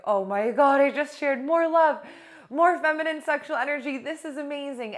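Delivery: high, loud, rushing delight; bursting with joy at a breakthrough; elated gushing. Retold or imitated. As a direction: gush like you just discovered something amazing. Retelling her own elated reaction.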